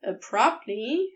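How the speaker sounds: plain reading voice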